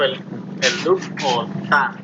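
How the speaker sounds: slow, spaced out dup o ta like a heartbeat; heart sound onomatopoeia. Shown as a demonstration. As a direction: mimic the heartbeat slowly. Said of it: "segundo tono cardíaco".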